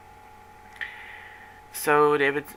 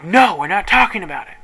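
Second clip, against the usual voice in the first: higher, louder mock angry snap; imitating an angry fit; 5 semitones higher, 7 dB louder than usual. Friend refusing to talk in the car, mock angry outburst. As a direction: throw his fit back at her, hammed-up. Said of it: "he had an absolute fit".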